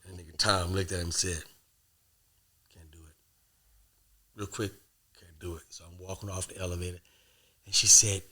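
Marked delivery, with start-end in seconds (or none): 2.7-5.6 very soft, low, flat refusal; cold refusal. Retold or imitated. Tom refusing the traitor in the Godfather scene, barely audible. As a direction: shut him down almost silently.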